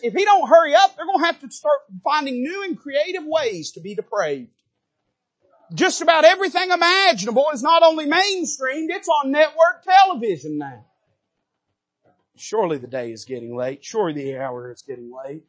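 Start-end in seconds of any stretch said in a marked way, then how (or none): 0.0-10.8 high, loud preaching burst; fiery preaching. Real, not acted. Preacher at full force.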